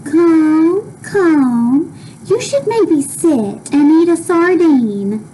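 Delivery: coaxing doctor. Acted. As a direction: coax them to sit down. Doctor's voice, read aloud.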